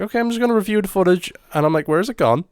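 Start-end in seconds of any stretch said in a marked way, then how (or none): 0.0-1.3 high and fast, carefree; high quick cheerful quote; breezy self-quote. Retold or imitated. Retelling his own breezy thought. 1.9-2.4 higher, rising alarm; mock panicked disbelief; retold panic. Retelling discovering lost audio.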